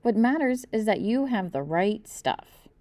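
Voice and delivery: ordinary delivery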